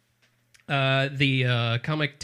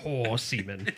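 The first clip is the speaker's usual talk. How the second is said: Sean Connery; 4 semitones lower, 4 dB quieter, slower than usual. Acted. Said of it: "Thank you, Daryl Hammond".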